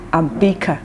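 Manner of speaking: ordinary delivery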